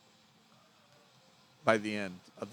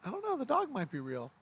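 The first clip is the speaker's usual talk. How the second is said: put-on child voice; 6 semitones higher than usual. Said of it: "the nine-year-old, is like".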